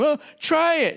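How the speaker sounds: mock a cheery server; chirpy hipster server. A hipster server pushing a sample.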